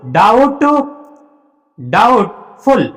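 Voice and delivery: Doubtful drawn out slowly, silent b; slow model word. Shown as a demonstration. Teacher spells out doubtful for students, slow careful model. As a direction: stretch doubtful out, syllable by syllable. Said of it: "बोलते हैं ना doubtful".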